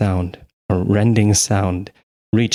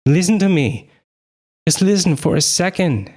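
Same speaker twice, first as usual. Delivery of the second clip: shouting; 9 semitones higher than usual. A narrator's reading. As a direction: yell to get through to her. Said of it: "she shouted".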